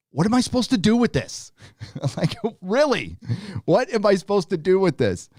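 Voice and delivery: high-pitched exasperated plea; helpless, incredulous venting. Real, not acted. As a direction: throw up your hands in disbelief. Exasperated fan venting about the show.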